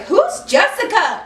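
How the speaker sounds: acted indignant outburst; indignant disbelief. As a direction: demand who on earth that is. Acted outraged reply to boyfriend.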